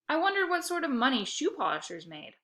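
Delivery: ordinary delivery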